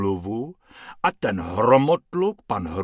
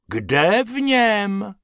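high, drawn-out puzzled question; uncomprehending, slow and raised; baffled; 9 semitones higher, 5 dB louder, much slower than usual. A narrator's reading. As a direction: stretch it out in loud disbelief. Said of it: "nechápal harmonikář".

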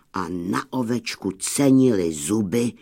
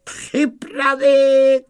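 high, loud, drawn-out gunner's order; called-out command; 13 semitones higher, 6 dB louder, much slower than usual. A narrator's reading. Gunner readying the loaded cannon, loud drawn-out call. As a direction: call out the command slowly and loudly. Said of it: "zavolal kanonýr".

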